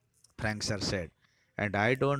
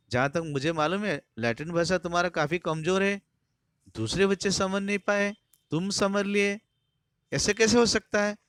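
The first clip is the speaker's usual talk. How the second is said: builds higher and louder into a demanding question; suspicious teacher; 9 semitones higher, 4 dB louder than usual. Retold or imitated. Harris retold to Taplow, building to a loud demand. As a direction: corner the pupil, getting louder.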